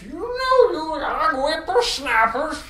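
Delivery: put-on high, creaky old geezer voice; very old man. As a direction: scold the kids like a cranky grandpa. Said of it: "how did I do the old man voice?".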